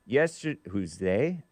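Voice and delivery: ordinary talk, nothing marked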